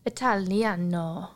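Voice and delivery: put-on slow, drawn-out Italian accent; mock Italian accent. Host playfully mimicking the Italian accent they love, slow Italian accent. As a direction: savour the word like an Italian. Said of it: "I like an Italian accent".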